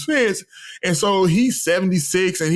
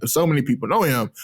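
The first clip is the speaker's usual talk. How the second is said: ordinary delivery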